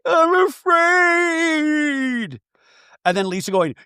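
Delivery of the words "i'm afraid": very high, very slow wailing sob; mock crying. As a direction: ham up tearful fear. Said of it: "like, crying".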